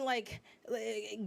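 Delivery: ordinary talk, nothing marked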